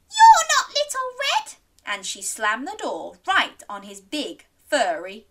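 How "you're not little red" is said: very high, loud granny yell. Acted. Granny finding the wolf at her door, high alarmed yell. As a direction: shriek it in alarm. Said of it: "yelled Granny".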